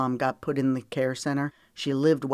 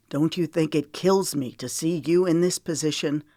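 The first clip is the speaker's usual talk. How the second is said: ordinary delivery, nothing marked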